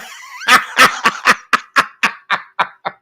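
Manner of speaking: airy burst of laughter; unvoiced chuckle; breathy laugh. Real, not acted. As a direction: laugh it off, half silent. Laughing at his own joke.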